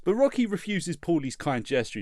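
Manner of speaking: ordinary talk, nothing marked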